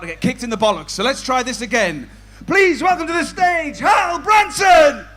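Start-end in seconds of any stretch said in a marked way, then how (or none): 2.5-5.1 high, booming stage introduction, last words drawn out; announcer's shout. Acted. Compere re-introducing the act to the crowd, high announcer shout.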